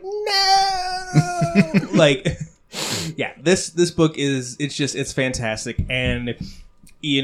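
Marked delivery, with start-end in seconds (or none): 0.0-1.9 high crying wail of dismay; anguished no. Retold or imitated. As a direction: wail it like the cliffhanger betrayed you. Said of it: "and I was like".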